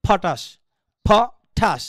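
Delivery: "ফটাস" like a chip packet popping; aspirated, bursting. Shown as a demonstration. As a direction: pop "ফ" and "ঠাস" apart with puffs of air. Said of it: "ওই রকম উচ্চারণগুলো হয় হচ্ছে আপনার গিয়া অ্যাসপারেটেড".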